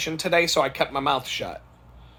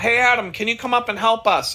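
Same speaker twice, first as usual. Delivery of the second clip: higher-pitched coworker calling out; imitated yell; 8 semitones higher, 4 dB louder than usual. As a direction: call for help from across the shop, mildly raised. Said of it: "one of my coworkers yells out".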